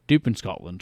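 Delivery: low, put-on Scottish voice; mock Scottish accent. Spy explains his accent, low mock Scottish voice. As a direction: play a Scot badly posing as Russian. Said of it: "speaking a Scottish accent".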